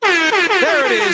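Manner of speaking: high, slow, drawn-out reveal; hyped reveal. Acted. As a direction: hold it up and call it out. Prop reveal.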